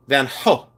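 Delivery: Gogh said as a breathed "ho"; foreign pronunciation attempt. Shown as a demonstration. Seller reading a viewer's version, Gogh as "ho". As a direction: try Van Gogh with an h for g. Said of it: "Is that the way you say it".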